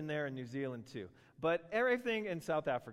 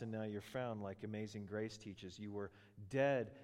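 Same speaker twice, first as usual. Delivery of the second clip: normal talking voice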